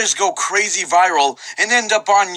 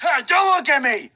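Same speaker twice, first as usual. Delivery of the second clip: shouting; 8 semitones higher than usual. Acted. Imagined dudes' shouting scene.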